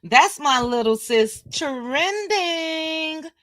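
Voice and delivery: high-pitched singsong greeting. Shown as a demonstration. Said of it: "I can't say it like that".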